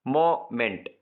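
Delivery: "Moment" in two separate beats; syllable by syllable. Shown as a demonstration. Teacher dividing words for exercise, "moment" split in two. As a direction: pause between मो and meant in "moment". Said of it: "दो syllables".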